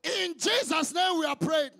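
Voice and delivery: prayer called out to the congregation; ending a prayer at the top of the voice; fervent prayer. Acted. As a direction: pray aloud with full conviction. Closes a loud congregational prayer.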